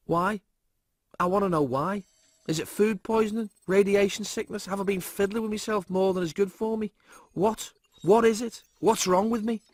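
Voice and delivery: put-on frantic young man demanding answers; worried slob voice; Lister panicking. Lister panics about hair loss.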